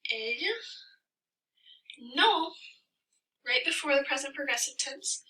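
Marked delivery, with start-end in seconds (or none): 0.1-2.5 dictated example. Shown as a demonstration. Writing out another example sentence.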